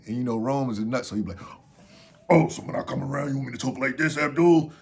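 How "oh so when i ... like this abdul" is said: louder mock-manly voice, a bit put on; mock man talk. Runner teasing the man who told him how to talk, mock-manly. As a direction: play up talking like a man. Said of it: "talk like a man".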